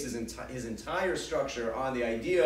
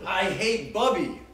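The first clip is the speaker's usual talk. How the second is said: put-on child's blunt complaint; 5 semitones higher, 5 dB louder, slower than usual. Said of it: "we'll say something like".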